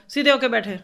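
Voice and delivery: loud stern command; harsh order. Shown as a demonstration. Teacher demos a harsh command to a student, loud. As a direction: bark it like a strict order. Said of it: "सख्ती है मेरी ज़ुबान में".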